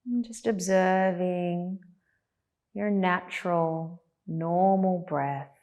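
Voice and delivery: spacious, unhurried guidance, letting each phrase rest; guided meditation. Acted. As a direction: settle them calmly into body and breath.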